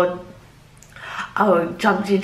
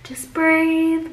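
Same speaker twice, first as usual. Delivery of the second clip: soothing nurse voice; 8 semitones higher, slower than usual. Retold or imitated.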